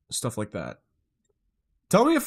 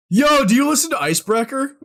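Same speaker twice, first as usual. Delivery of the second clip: much higher and louder, eager yell; excited question; 14 semitones higher, 9 dB louder than usual. Real, not acted. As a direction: pounce on the question, thrilled. Excited question to German chatter.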